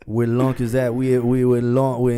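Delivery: put-on Texas accent. Joking with his co-host, a hammed-up Texas accent. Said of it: "That's my Texas accent".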